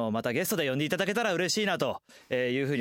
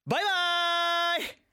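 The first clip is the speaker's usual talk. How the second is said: cheery drawn-out goodbye; 13 semitones higher, slower than usual. Acted. Playful sign-off to listeners.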